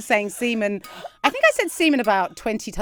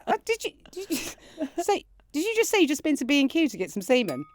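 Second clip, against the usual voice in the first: higher, fast teasing disbelief; incredulous; 7 semitones higher, faster than usual. Retold or imitated. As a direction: push it up in giddy shock. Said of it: "I said".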